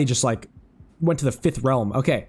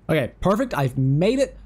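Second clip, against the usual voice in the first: ordinary talk, nothing marked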